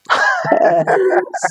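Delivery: short high bright laugh. Real, not acted. Guest laughing at his relatives' accent remark, short high laugh. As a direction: burst into a quick laugh.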